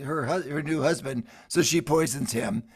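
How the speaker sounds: ordinary talk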